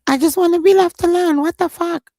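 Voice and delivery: high, loud whiny mock voice; mocking whiny imitation. Mocking Sean's complaint for viewers, high whiny imitation. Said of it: "When he was like".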